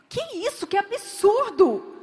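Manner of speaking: indignant cry, rising high; outraged protest. Acted. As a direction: bristle with offended disbelief.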